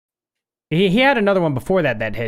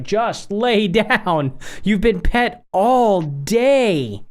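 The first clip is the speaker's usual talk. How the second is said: fed-up plea; 4 semitones higher, much slower than usual. Retold or imitated. Fed up with the needy dog.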